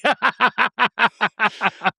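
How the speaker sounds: long high run of laughter; giggling on cue. Shown as a demonstration. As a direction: keep the practice laugh rolling. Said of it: "Now you start giggling".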